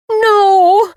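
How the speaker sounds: high, loud, anguished shout of no; anguished cry. A narrator's reading. Livy seeing Hector's agony, cries out loud and high. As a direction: cry out the word in grief. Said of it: "I cried".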